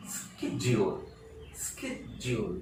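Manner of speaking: schedule slowly with a hard "sk" start; model pronunciation. Shown as a demonstration. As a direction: draw out "SKED-jool" for learners. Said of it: "The word should be pronounced as". (schedule का उच्चारण "स्केड-ज्यूल", धीरे)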